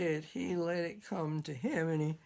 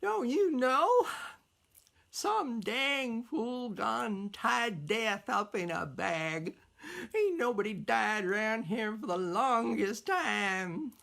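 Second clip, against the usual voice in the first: put-on cranky old woman; 4 semitones higher than usual. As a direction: snap it out like an old crone.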